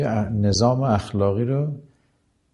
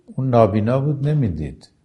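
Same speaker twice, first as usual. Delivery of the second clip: normal talking voice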